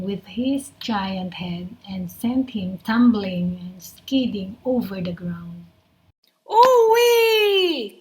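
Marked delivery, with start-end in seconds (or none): 6.5-8.0 high, loud yelp of pain; pained cry. A narrator's reading. Crocodile crying out as the hippo hits him. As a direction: shriek as you're knocked over. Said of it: "cried the crocodile".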